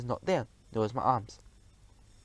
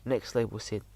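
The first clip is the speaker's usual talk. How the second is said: ordinary delivery, nothing marked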